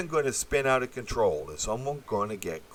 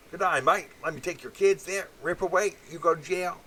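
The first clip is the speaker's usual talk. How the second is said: high, hammed-up Aussie twang; lampooning an Australian official, high and broad; mock Australian accent; 8 semitones higher than usual. Mocking how Australians see themselves, in a hammed-up Australian accent. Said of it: "thinking that this is the Australian way".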